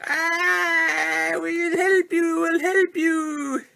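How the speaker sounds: little buddies eagerly shouting their help; tiny helper robots. Retold or imitated. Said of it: "his little Target Master buddies were all like".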